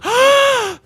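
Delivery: a sharp high gasp of fake shock; hammed-up pearl-clutching gasp; mock shocked gasp. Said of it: "hand on the chest, like he's clutching his pearls".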